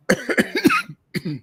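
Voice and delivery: loud put-on coughing fit; exaggerated hacking cough; mock allergic cough. Feigns allergy to wrestler's name.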